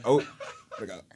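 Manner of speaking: ordinary talk, nothing marked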